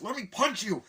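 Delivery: high, loud demand; acted outburst mid-fight; mock fight yell. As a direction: imitate a frustrated brawler. Acting out the fight.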